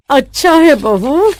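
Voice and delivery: odd, strained voice through a bad mouthful; forced approval. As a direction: praise food that tastes awful. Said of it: "अपनी ही मुंह का स्वाद बिगाड़ना पड़ता है".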